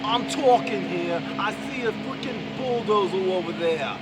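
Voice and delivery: loud, high hammed-up Bronx shout; New York accent. Vlogger practising Bronx talk, shouting about a bulldozer, New York accent. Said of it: "I think I'm finding it".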